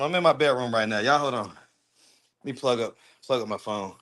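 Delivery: ordinary talk, nothing marked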